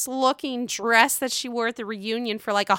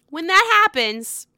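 usual speaking voice

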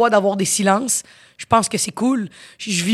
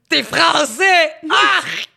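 slow, high shout breaking into laughter; shouted punchline; 8 semitones higher, much slower than usual. Real, not acted. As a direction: land the punchline with a yell and laugh. Chute en criant et riant. (chute criée, lente et aiguë, puis rire)

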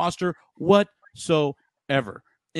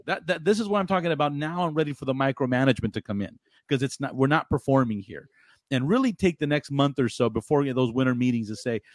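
ordinary talk

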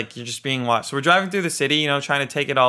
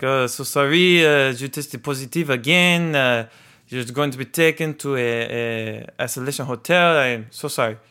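put-on French accent. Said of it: "some French guy".

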